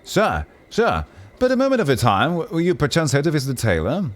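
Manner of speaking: high, eager pleading courtier voice; fussy noble. Acted. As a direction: rush up and beg for attention, pitched high.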